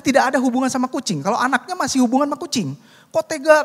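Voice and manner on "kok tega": high indignant accusing cry; neighbor's child accusing. Retold or imitated. Child accuses pastor over dead cat, high indignant cry. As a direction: throw the blame at him, pitched up.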